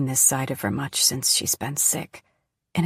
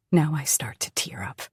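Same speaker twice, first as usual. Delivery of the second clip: plain reading voice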